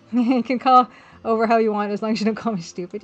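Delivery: normal talking voice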